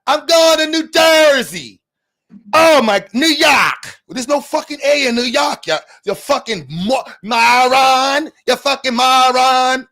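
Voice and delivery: loud, high mock Boston accent. New Yorker mocking how Boston says New York, Boston accent. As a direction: stretch every A in a hammed-up Boston accent. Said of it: "who puts that many A's in".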